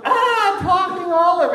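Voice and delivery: high, loud scream of disgust; shriek. Acted. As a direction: shriek at the talking olive. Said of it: "And she goes".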